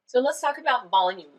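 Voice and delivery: ordinary talk, nothing marked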